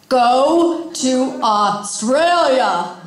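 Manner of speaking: slow, drawn-out call from within; booming inner voice. Retold or imitated. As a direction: stretch every word like a voice that won't be ignored. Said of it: "the voice got loud".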